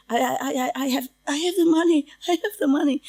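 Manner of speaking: high, quick stammering plea, acted out; begging. Imitating a shaking, scared customer who keeps being shooed out, begging. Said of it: "Like begging for it".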